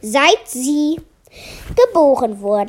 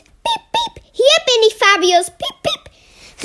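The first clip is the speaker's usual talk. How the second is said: much higher squeaky peeping with a giggle; 11 semitones higher than usual. Acted. Melia peeps to Fabius from behind the corals, high and squeaky. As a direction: squeak it out like a little mouse. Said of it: "Und Melia piept".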